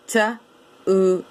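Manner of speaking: TODAY as separate sounds, t, oo, d, ay; "T-U-D-A" as sounds with gaps, not letter names. Shown as a demonstration. Teacher counting sounds, TODAY sound by sound. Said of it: "Five sounds".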